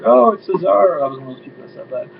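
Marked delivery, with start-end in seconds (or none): 0.1-1.0 higher, louder excited guess; mock excited guess. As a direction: play the thrilled crowd guessing. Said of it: "everyone's like".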